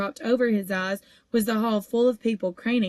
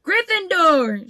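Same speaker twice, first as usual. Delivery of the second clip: slow, loud, much higher drawn-out shout; 9 semitones higher, 7 dB louder, slower than usual. A narrator's reading. Sorting Hat announces to the hall, a drawn-out shout. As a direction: stretch it out triumphantly. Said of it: "shouted the Hat".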